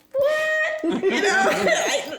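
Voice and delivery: high, loud laughing disbelief. Real, not acted. Laughing at kids' accusation.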